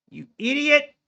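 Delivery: you idiot, yelled at self; self-scolding yell. Real, not acted. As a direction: snap at yourself. Berating himself over trade.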